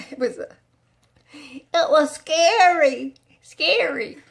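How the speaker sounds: put-on slow, shaky old-lady voice. Telling viewers she felt ten years older, slow old voice. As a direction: drag it out like you've aged ten years. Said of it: "And I talk like this".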